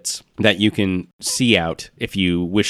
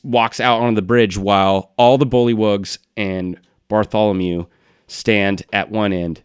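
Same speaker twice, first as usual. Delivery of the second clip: ordinary delivery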